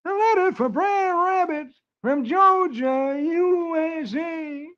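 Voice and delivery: very high, slow drawling postmaster; high, slow cartoon postmaster. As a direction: stretch it out in a squeaky comic postmaster voice. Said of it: "the postmaster says".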